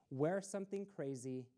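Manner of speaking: plain reading voice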